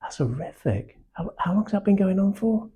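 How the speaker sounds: hushed shocked sympathy; a whispered gasp of real concern; feeling it first. Shown as a demonstration. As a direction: draw them into the bad feeling. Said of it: "I need them to feel negative".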